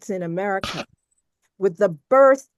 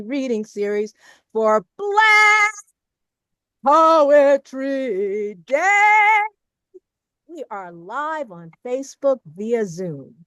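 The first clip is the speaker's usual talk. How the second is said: ceremonial announcer; 3 semitones higher, 5 dB louder than usual. Acted. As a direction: open the show like an MC, stretching the big words. Opens event as ceremonial announcer.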